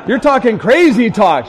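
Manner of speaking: loud, high, comic exasperation; hammed-up incredulous outburst; exclaimed protest. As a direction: cry out in acted disbelief. Acted protest in couple's argument.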